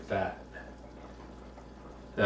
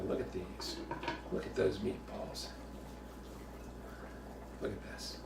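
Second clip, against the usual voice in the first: hushed whisper; 4 semitones lower, 14 dB quieter than usual. Acted. Admiring meatballs in hushed voice.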